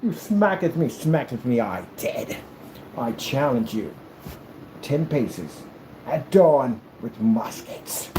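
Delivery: mock-shocked, then gravely measured; hammy old-time gentleman, pausing for effect; affronted duelist. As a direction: issue the challenge with wounded dignity. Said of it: "you turn, you're like".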